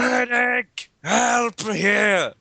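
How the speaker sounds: high shouted battlefield cry; hammed-up war-movie shout; mock medic call. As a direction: call out for a medic like a wounded soldier.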